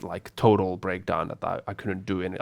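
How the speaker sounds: ordinary talk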